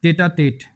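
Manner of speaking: टेटा टेट, spoken crisply with even syllables; pronunciation demo. Shown as a demonstration. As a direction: say टेटा टेट clearly for the class. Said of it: "इसकी pronunciation होती है टेटा टेट". (उच्चारण: टेटा टेट, साफ़-साफ़)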